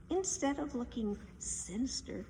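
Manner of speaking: usual speaking voice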